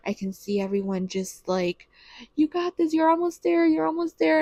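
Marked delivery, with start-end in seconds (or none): 2.4-4.4 high, eager encouragement; cheering her on. Retold or imitated. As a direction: imitate a birth team urging the mother on. Acts out everyone cheering her on.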